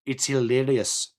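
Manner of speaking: lightly put-on South African accent; a hint of South African, deadpan; a touch of a South African accent, dry and amused. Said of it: "South African accent".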